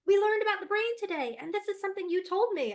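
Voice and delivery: high, fast, loud kid voice imitating her son; excited child. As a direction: bubble over like an eager little boy. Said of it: "he was like".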